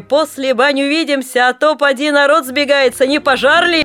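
loud, high laughing shouts; women shouting. A narrator's reading. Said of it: "Кричат, смеются". (кричат, смеясь, звонкими голосами)

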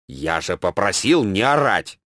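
usual speaking voice

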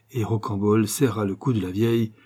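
usual speaking voice

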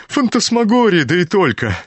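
high, loud astonished outburst; stunned amazement. A narrator's reading. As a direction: cry out in sheer disbelief. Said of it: "Почти ошеломлённый от изумления проговорил генерал".

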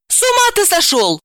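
very high exasperated retort; annoyed, indignant woman; irritated. Acted. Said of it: "раздражённо спросила".

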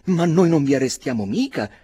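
voce acuta e forte, impaurita; si affretta a rassicurare; l'avvocato. A narrator's reading. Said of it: "disse l'avvocato". (l'avvocato rassicura Barry, acuto e nervoso)

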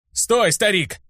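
высокий окрик без прибавки громкости; окликни старика, чтобы остановить; выкрик. A narrator's reading. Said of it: "Успел выкрикнуть я". (предостерегающий окрик)

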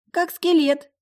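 very high, loud amused reply; stifled amusement. A narrator's reading. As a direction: pipe up as if about to giggle. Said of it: "прыснула в кулак Фабиана". (прыснуть высоким голосом)

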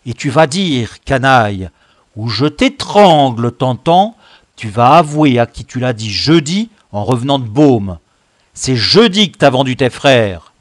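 loud, angry interrogation; threatening demand. Acted. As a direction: force a confession with threats. Chief threatens to strangle the accused. (menacer d'une voix forte et furieuse)

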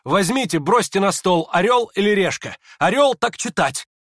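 high, loud, urgent orders; hurried instructions. A narrator's reading. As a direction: rap out the orders excitedly. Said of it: "торопливо пригласил Ипполит".

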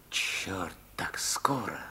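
surprised whisper. Acted. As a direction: hiss it under your breath. Startled by visitors coming.

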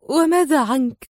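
choked worry for him; choked, dry voice. A narrator's reading. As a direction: squeeze it out, throat tight. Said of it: "بصوت مختنق جاف".

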